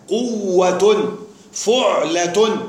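قوة drawn out very slowly, each part clear; slow syllable demo. Shown as a demonstration. Teacher to students, spelling out قوة very slowly. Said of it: "قوة بواوين".

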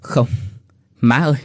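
broken stammer; stammering desperate wife; stammering plea. Acted. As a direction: stumble over the words in panic. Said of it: "Nguyệt lắp bắp đáp".

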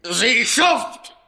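high, loud enraged scream; man losing control, screaming at the crook; furious yell. Acted. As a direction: scream the insult with everything. Insulting him at the climax.

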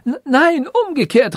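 stammered, high-pitched protest; frantic high outcry; crying out. A narrator's reading. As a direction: blurt out a desperate correction. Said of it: "rief der Weise".

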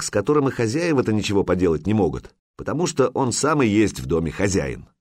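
ordinary delivery

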